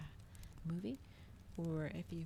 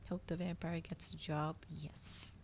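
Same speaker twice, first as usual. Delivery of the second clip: plain reading voice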